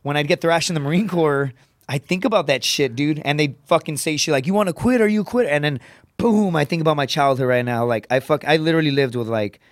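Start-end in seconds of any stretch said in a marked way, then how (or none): 4.5-5.5 taunting drill instructor. Retold or imitated.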